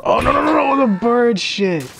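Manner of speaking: loud, high, wailing no-no-no; panicked yell. Real, not acted. Player slipping on bird droppings, panicked yelling. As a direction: cry out in alarm, very high.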